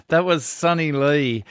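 laughing through the words; amused chuckle into the name; real laugh while speaking. Real, not acted.

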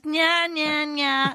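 nya nya, teasing sing-song; sing-song taunting. Retold or imitated. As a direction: taunt like a schoolyard kid. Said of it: "Victoria goes".

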